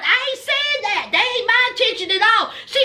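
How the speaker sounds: ordinary delivery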